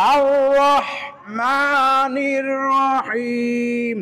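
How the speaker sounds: very slow, drawn out; careful recitation. Shown as a demonstration. Teacher recites the verse slowly as a warning example. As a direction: recite each sound deliberately. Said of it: "এখানে হচ্ছে সতর্ক বার্তা".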